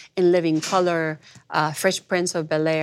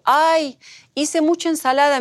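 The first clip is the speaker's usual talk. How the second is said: higher, louder chirpy friend pretending it's nothing; Debbie; 8 semitones higher, 4 dB louder than usual. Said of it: "me decía Debbie".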